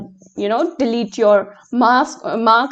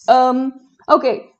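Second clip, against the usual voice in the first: normal talking voice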